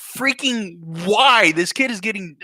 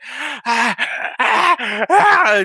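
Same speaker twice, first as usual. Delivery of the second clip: rising, louder cries of pain; mock groans of pain; 6 semitones higher, 4 dB louder, much slower than usual.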